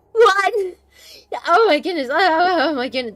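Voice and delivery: loud, high mock shock, then dropping back; shocked reaction. Streamer's brain reacts to the girl's age, loud shock. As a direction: blurt out your stunned inner reaction. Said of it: "that's how my brain reacted".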